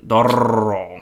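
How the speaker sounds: Toro with a trilled R; rolled R. Shown as a demonstration. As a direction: roll the R in Toro. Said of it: "You gotta roll your R's".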